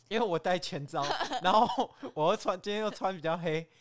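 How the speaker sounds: giggling through the words; stifled giggles. Real, not acted. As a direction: hold back a laugh while telling it. Laughing while telling the story. (忍住笑着说)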